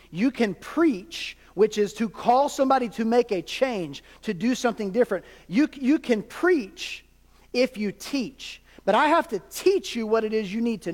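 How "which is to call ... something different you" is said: ordinary talk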